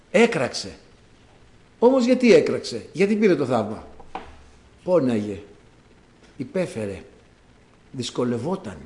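ordinary talk, nothing marked

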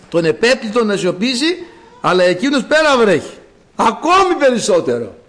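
high, insistent exhortation; fervent preaching. Acted. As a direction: urge the congregation on in rhythmic bursts.

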